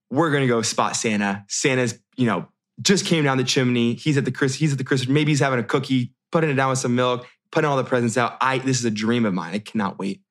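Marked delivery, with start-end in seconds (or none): none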